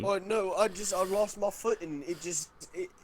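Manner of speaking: panicked excuse. Acted. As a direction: blurt out a frantic excuse. Bilge pleads, caught by father.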